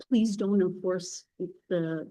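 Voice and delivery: ordinary delivery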